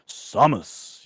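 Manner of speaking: "Samus" with an open ah, quoting an announcer; first syllable of "Samus" like sah; SAH-mus pronunciation. Retold or imitated. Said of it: "the announcer pretty clearly says".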